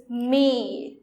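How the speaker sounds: "may" as one syllable; a single drawn-out "may"; vowels run together. Shown as a demonstration. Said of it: "if you forgot to put this accent".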